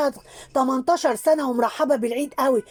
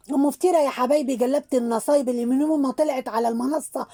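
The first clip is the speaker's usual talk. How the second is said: normal talking voice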